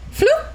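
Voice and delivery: high-pitched little "floop"; floop sound effect. Retold or imitated. Imitating a thing instantly dropping, high floop sound effect. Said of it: "you know what I mean when I say that".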